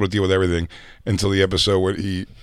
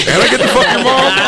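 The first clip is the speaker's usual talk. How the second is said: put-on high, laughing young guy voice; high teen voice; 14 semitones higher, 5 dB louder than usual. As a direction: squeak it out through a laugh. Voicing teen boyfriend, laughing.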